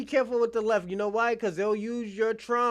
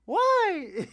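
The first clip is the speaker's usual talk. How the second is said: mock whiny why; 9 semitones higher than usual. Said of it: "That motherfucker was Cuban".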